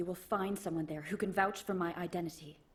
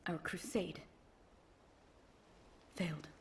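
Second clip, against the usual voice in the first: hushed defeat; 3 semitones lower, 9 dB quieter than usual. Acted. Knight admits the crusade's defeat.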